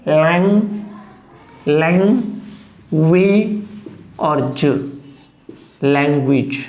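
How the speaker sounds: लैंग, then "wi", then "j", then लैंग्वेज whole; sound-by-sound pronunciation. Shown as a demonstration. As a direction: break लैंग्वेज into pieces with pauses. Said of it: "देखिए लैंग".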